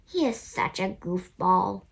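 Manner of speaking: ordinary delivery, nothing marked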